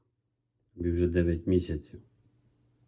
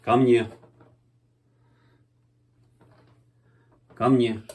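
louder, higher call to come; calling a dog; 4 semitones higher, 5 dB louder than usual. Real, not acted. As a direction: call the puppy over.

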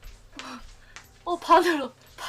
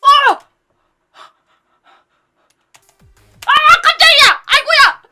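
startled high yelp of fright; scared horror game streamer; 9 semitones higher, 11 dB louder than usual. Real, not acted. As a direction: jump out of your skin, yelling.

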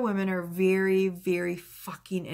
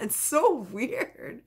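laughing; 6 semitones higher, much slower than usual. Real, not acted.

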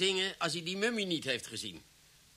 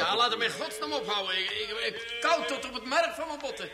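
much higher, shaken voice stumbling over words; chilled to the bone, barely holding it together; frightened; 8 semitones higher than usual. Acted. Officer unnerved by the madman's singing, frightened.

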